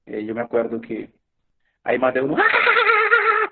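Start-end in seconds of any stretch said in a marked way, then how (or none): 2.4-3.5 very high, loud imitated wail; mock wailing. Man at prayer circle starts crying, mock wailing. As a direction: burst out bawling in imitation. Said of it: "Comenzó a llorar".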